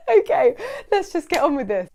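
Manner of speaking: laughing. Real, not acted. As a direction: push on through the giggles. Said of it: "laughing after her slip".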